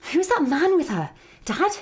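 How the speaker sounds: ordinary talk, nothing marked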